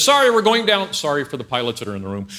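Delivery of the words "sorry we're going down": loud, raised mock pilot announcement. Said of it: "The pilot says".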